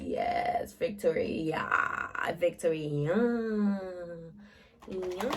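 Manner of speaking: soft, breathy, smug victory whisper; whispered gloat. Retold or imitated. Killer quietly gloating while waiting for victims to die. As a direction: gloat under your breath as if nobody may hear. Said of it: "So he was just smirking".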